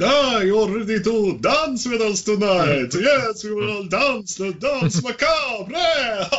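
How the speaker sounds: high, slow hammy vampire voice; fake vampire voice. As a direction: ham it up like a stage vampire. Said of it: "fake sort of, like, vampire voice on".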